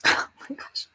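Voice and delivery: barely voicing the gratitude; overcome, thanks whispered. Real, not acted. Thanking viewers, overwhelmed.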